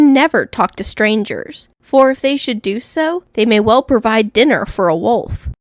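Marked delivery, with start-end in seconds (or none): none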